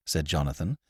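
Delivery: usual speaking voice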